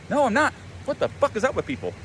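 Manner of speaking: high-pitched indignant protest; acted exasperated denial. He denies being cocky to his inner critic, exasperated protest. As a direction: deny it, voice jumping up in mock outrage.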